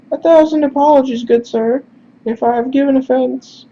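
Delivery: quick, apologetic falsetto; high-pitched character voice. Acted. Heavy-set man apologizes to a stranger, high-pitched voice. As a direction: apologize politely in a very high put-on voice. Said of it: "we're just gonna do high-pitched instead".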